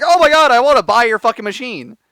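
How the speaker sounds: high, loud mimicked enthusiasm; excited crowd. Host retelling the showcase audience's reaction, mock excited. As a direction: imitate eager buyers clamouring. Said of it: "everybody's like".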